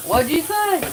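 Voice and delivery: high, loud, puzzled question; baffled listener. Retold or imitated. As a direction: imitate someone who didn't catch a word. Acting out puzzled northern listeners.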